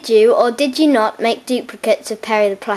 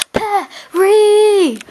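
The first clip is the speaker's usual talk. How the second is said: calling out; 9 semitones higher, 4 dB louder than usual. Acted. Calling out for Perry.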